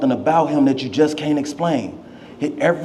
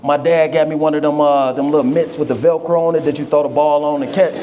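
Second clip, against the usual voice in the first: ordinary talk, nothing marked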